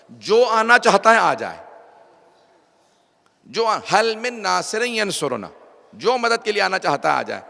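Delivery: preacher voicing a ringing appeal; calling for help. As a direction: call out across a battlefield. Retold battlefield call for helpers.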